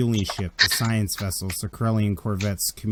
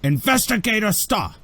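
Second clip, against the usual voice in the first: a much higher, louder officer's voice; commanding boss; 12 semitones higher, 5 dB louder than usual. Acted. The Federation boss questioning the investigator, high and clipped. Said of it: "he's like".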